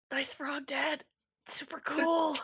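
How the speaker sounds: high, quiet, half-hearted praise; small high voice forcing out approval; unimpressed son. Acted. Son humours his dad about the frog, high and quiet. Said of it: "He goes".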